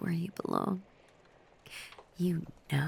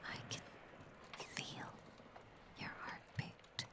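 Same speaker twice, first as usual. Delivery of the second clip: possessive whisper; 10 dB quieter than usual. Acted. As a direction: whisper it like a secret over their heartbeat. Listening to the captive's heartbeat.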